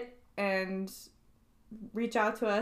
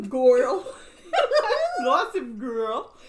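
Goofy voice; 4 semitones higher, 6 dB louder, much slower than usual. Pretend. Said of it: "Hosted by Goofy, apparently".

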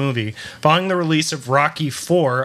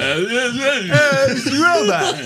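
marbles in mouth; 10 semitones higher than usual. Retold or imitated. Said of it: "I got marble in my mouth".

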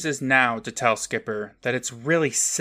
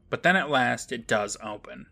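usual speaking voice

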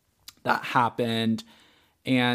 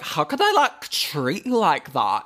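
put-on Australian accent; 2 semitones higher than usual. Retelling friend's outrage in a mock Australian accent. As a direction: ham up a thick Australian twang. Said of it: "these intense fucking Australian accents".

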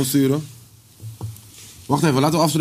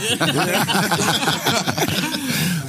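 higher-pitched burst of real laughter; hearty laugh; 6 semitones higher than usual. Real, not acted. Friend reacts to mock auction bids, laughing.

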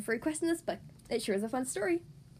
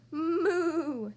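cow moo; 3 semitones higher than usual. Pretend. Cow speaks in story.

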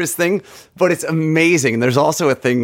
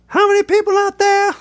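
[name] rant. Retold or imitated. Mimicking a rock frontman hyping the arena crowd, high shouted. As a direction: whip the crowd up like a rock star. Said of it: "He has these rants".

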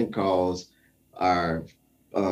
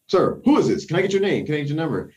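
higher, puzzled reporter voice; imitating the news guy; 6 semitones higher, faster than usual. News station staffer asks the caller for his name, higher and puzzled. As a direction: sound like a startled newsroom staffer. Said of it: "And the guy was like".

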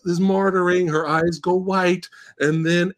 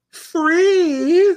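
high, disbelieving squeak on Free; incredulous mock; 13 semitones higher, 4 dB louder than usual. Real, not acted. Mocking the heroine telling the girl to run, high incredulous squeak. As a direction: scoff at the line in high disbelief.

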